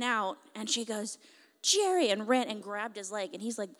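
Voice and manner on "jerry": high, loud cry of a name; excited child's shout. Retold or imitated. Little girl running to the preacher she prayed for, excited shout.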